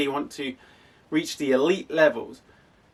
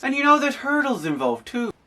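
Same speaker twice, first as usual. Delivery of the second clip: imitating the coach in a higher Irish accent; 10 semitones higher than usual. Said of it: "in my best Irish accent".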